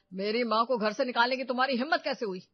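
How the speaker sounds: loud, furious demand at his wife; angry confrontation. Acted. Son confronts wife for throwing out his mother, angry and loud. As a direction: hurl the accusation at her.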